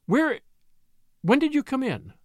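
startled question. A narrator's reading. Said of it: "quickly recovering herself".

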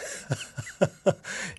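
airy rising laugh, no voice behind it; breathy laugh. Real, not acted. Amused by the host's question about his accent, laughing. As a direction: let a soft high laugh slip out.

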